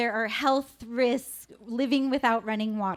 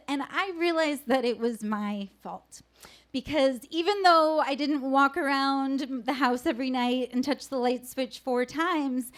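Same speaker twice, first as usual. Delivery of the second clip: ordinary talk, nothing marked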